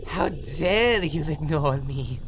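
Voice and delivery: loud, drawn-out outrage; slow, booming villain indignation; indignant rage. Acted. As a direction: stretch the words in theatrical fury. Villain enraged at being ignored.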